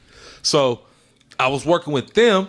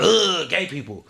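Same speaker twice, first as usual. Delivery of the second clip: mock disgust.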